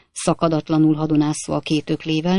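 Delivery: ordinary delivery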